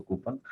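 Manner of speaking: "कूपन" as "koo-pun"; short "pun" ending in "कूपन"; common mispronunciation. Shown as a demonstration. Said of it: "जल्दबाजी में वो लोग बोल". ("कूपन" के अंत में छोटा "पन")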